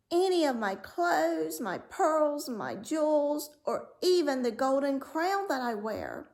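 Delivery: put-on high, pleading girl's voice; eager princess. A narrator's reading. Princess listing treasures to the frog, high pleading. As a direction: pile on the offers, high and eager. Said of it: "said she".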